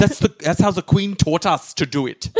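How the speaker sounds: high, put-on German accent; German posing as British. Spy reading a book upside down, high German-accented cover voice. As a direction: protest innocence in a bad German-tinged British accent. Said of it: "talks with a British accent or a German accent".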